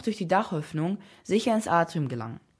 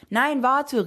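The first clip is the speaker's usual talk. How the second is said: raised, high-pitched call; calling after someone; 8 semitones higher, 5 dB louder than usual. A narrator's reading. Slave calling after the departing boy. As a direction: call out urgently to stop them. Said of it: "rief ihm der Sklave hinterher".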